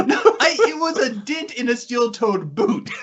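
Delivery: high, laughing protest; laughing disbelief at the teasing, insisting on it. Real, not acted.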